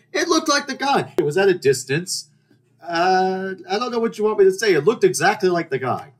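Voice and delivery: high, insistent mock indignation; exasperated self-defence. Storyteller excuses mistaking a stranger, exasperated protest. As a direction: plead your case with pitch way up.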